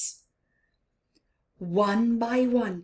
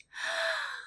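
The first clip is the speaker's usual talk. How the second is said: very high sharp gasp; startled gasp; shocked gasp; 7 dB quieter than usual. Acted. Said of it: "He gasped".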